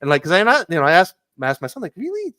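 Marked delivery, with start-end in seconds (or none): 2.0-2.4 high, incredulous questioning; surprised question. Retold or imitated. Parent asking son about his brother's accent, surprised. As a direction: jump up high in disbelief.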